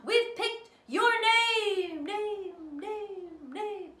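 high, loud voice with fading echoes; echoing announcement. Acted. Reading the prize note, loud with echoes. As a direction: ring out each name like a booming echo.

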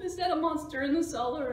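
high, loud frightened question. A narrator's reading. Cats fearing a monster in the cellar, frightened. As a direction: ask in mock dread.

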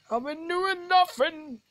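high, drawn-out whine of denial; protesting schoolkid. Acted. Accused pupil denying it to the teachers, high indignant protest.

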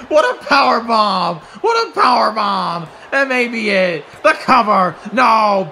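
high loud excited play-by-play; excited shouting. Real, not acted. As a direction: shout the big moment. Power bomb and near fall.

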